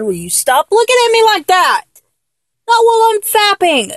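high, exasperated plea to stop; flustered protest. Acted. Telling a lover to stop staring, flustered and annoyed.

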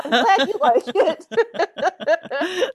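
high, drawn-out laughing words; amused, giggling thanks; laughing reply. Retold or imitated. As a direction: dissolve into laughter as you say it. Retold reply to compliment.

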